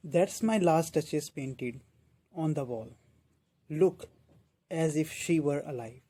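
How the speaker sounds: very slow, deliberate poem reading; measured classroom verse reading; poem recitation. Acted. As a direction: recite each phrase slowly, with long pauses.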